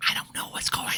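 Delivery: whispered aside, imitating the spouse; hushed mock cluelessness; mimicked helpless whisper. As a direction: mouth it in a soundless whisper. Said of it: "she does like that".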